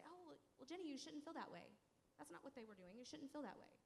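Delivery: high, preachy motherly correction; mom's voice. Retold or imitated. Mother telling her daughter how to feel, high and preachy. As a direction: lecture the child like a godly mom.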